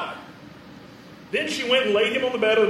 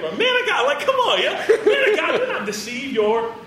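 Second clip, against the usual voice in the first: much higher, louder laughing protest; frustrated woman; 8 semitones higher, 3 dB louder than usual. Acted. Woman laughing off the prophet's promise, exasperated. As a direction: beg him not to tease her. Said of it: "like she was frustrated".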